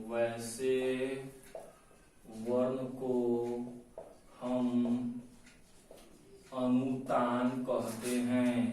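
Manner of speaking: low, soft, drawn-out words; slow dictation. Shown as a demonstration. Teacher dictating the term's definition, slow dictation. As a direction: leave long pauses for writing between words. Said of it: "ऐसे लिखिए".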